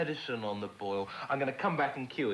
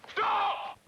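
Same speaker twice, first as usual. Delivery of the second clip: barked commands. Shown as a demonstration. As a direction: shout it at the remote. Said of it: "like so".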